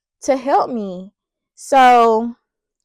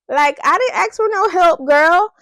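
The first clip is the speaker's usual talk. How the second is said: high-pitched sassy objection; indignant inner protest; 7 semitones higher, 4 dB louder than usual. Retold or imitated. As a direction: push back, pitched up and affronted. Indignant inner reply to manager.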